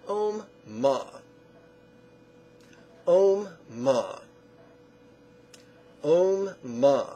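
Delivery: "Om, ma" as two separate held syllables; syllable stacking. Shown as a demonstration. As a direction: recite "Om, ma" slowly for students to echo. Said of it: "Now two syllables".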